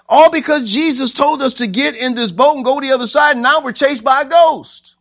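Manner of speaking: high, loud frantic wail; panicked complaint. Acted. Disciple in the boat chased by a ghost, frantic shout. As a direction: complain at the top of your panic.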